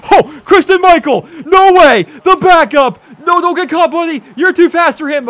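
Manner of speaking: loud high yelling at a big run; thrilled game-call shouting; excited shouting. Real, not acted. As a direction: cheer the runner on at full voice. Excited football play call.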